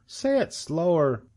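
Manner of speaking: high, slow piglet request; puzzled young pig. A narrator's reading. Pig asking fast-talking goose to slow down, high and slow. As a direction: spell it out in a high voice.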